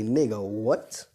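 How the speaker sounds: slow, stretched incredulous question; drawn-out disbelief. Acted. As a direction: draw out the final word, rising in disbelief. Same stunned reaction, retold again.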